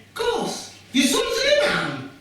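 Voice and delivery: very high gushing voice; gushing admirers. Retold or imitated. People praising the mother about her imam son, high gushing. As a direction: gush like a proud aunty. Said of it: "People often say".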